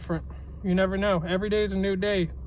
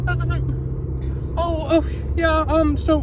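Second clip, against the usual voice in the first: shaken; 9 semitones higher, 4 dB louder, much slower than usual. Real, not acted. Still panicking, struggling.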